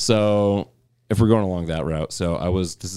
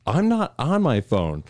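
higher, slow, fed-up reply; indignant protest; 7 semitones higher, slower than usual. Retold or imitated. Answering the cop in the retold story, indignant. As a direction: insist you're innocent.

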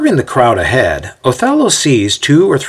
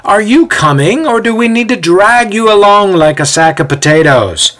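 raised, high-pitched nagging call; impatient calling back; 11 semitones higher, 5 dB louder than usual. A narrator's reading. Ezio to lagging Othello up the road, impatient calling. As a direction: call back to hurry a straggler. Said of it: "Ezio calls back from some twenty feet ahead".

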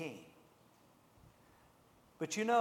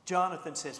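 ordinary talk

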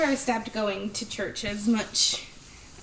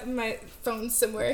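ordinary talk

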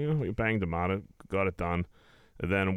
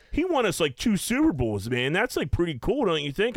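higher, eager fan pleading his case; a hint of a pushy starstruck dude; mildly higher earnest fan; lightly voiced starstruck fan; 7 semitones higher than usual. Guy defending Jeff Reed retold.

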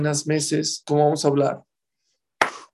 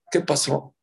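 ordinary delivery

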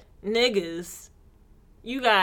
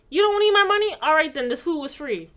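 higher, louder mock indignation; sassy comeback; 7 semitones higher, 4 dB louder than usual. Customer answering the restaurant owner back, sassy retort. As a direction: answer back with raised pitch.

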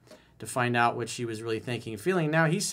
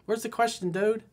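normal talking voice

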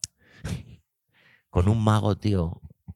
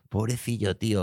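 ordinary talk

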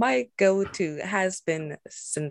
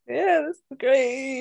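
blissful sigh; 9 semitones higher, slower than usual. Retold or imitated. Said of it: "just be like".

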